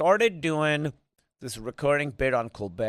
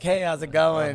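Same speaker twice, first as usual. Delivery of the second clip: cheerful delivery guy; 5 semitones higher, 5 dB louder than usual. Retold or imitated. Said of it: "I keep showing up to be like".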